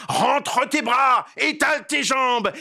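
furious yell. Acted. The raging witch yells orders at Dégourdi. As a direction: scream it in rage. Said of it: "lui hurle".

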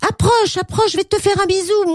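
very high, eager little voice; Pixie. Acted. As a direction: pipe up in a high, excited cry. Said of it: "Et Pixie criait". (petite voix très aiguë, cri enthousiaste)

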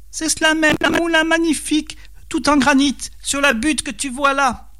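very high snake voice; boasting devil serpent. A narrator's reading. Serpent promising peasant a granite mill, high coaxing voice. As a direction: sell it grandly in a high creature register.

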